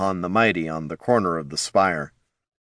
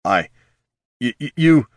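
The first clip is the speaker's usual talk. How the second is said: stammering; 4 semitones higher, 4 dB louder than usual. Acted. Jin stammering.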